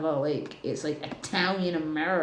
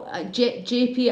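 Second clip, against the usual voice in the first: ordinary talk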